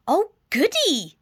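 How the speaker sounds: put-on high, bright old lady voice; cheerful granny. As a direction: chirp it like a happy grandma. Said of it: "said Granny".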